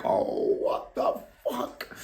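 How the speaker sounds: slow, breathless whisper; panicked whisper. Retold or imitated. Hiding from police in the living room, whispering. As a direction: whisper it in shock. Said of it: "I'm like".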